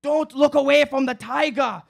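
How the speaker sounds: high, loud commanding shout; hallucinated yell. Retold or imitated. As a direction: bark it like a kung fu master. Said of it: "yelled through the screen".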